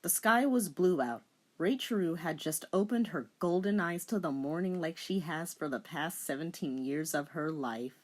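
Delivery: quiet storybook narration; dramatic story reading. Acted. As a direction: read it like a fanfic narrator.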